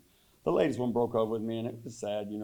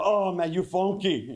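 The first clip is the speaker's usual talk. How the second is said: higher, slow mock girlfriend line; 7 semitones higher, 4 dB louder, slower than usual. Comic voicing his ex's parting insult, higher and slow. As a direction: drawl it out as the ex. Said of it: "And she's like".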